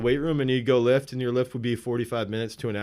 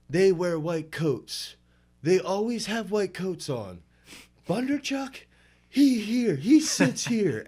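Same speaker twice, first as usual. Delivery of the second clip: higher, slow, emphatic lecturing; imitating coach's broken English; 6 semitones higher, slower than usual. Athlete mimics coach explaining the Soviet theorists. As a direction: spell it out like the coach at the whiteboard. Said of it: "he would always go back to".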